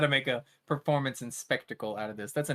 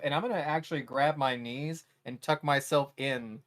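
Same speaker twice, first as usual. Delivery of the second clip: ordinary talk, nothing marked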